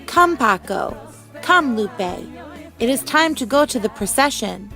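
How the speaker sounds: ordinary delivery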